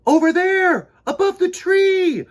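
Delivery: thrilled shout of discovery; excited pointing. Acted. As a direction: call out in wonder.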